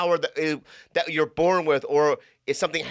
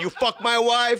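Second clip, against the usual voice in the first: higher-pitched mock Italian accusation; Italian guy impression; 6 semitones higher than usual. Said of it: "doing that impression of an Italian guy".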